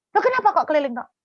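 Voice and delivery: high, loud, quick-fire questioning; puzzled nagging wife. Retold or imitated. Wife pesters husband about the marching, high and quick.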